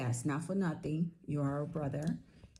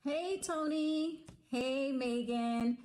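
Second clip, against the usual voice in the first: drawn-out happy greeting; 8 semitones higher, much slower than usual. Real, not acted. Cheerful greeting in video message.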